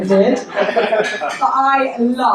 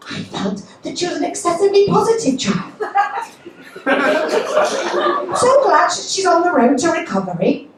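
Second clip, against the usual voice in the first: high, insistent mother's text voice; hammed-up fretting, pressing each point; anxious, indignant mother; 10 semitones higher, 3 dB louder than usual. Mother texts daughter about injured grandchild, high mock-anxious scolding.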